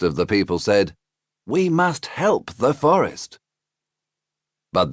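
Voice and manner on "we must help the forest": people's voice. Acted.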